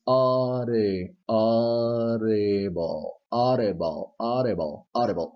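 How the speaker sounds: "audible" slowly with a tapped d; slow repeated "audible" with the flap; flap D demo. Shown as a demonstration. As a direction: stretch out "audible" so the flap shows. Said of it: "with the flap D effect".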